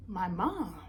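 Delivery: ordinary talk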